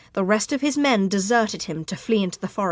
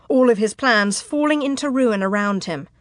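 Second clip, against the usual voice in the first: plain reading voice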